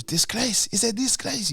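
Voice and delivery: high, outraged mimicry with laughter creeping in; Drogba. Player quoting a footballer's viral outburst, mock outraged. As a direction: mimic a footballer's indignant rant. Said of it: "that video of Drogba where he goes".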